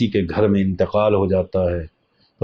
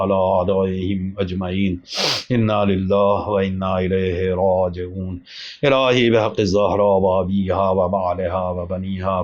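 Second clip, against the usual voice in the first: closing prayer; 3 semitones lower than usual. Acted. Closing supplication after majlis. (آہستہ دعائیہ لہجہ)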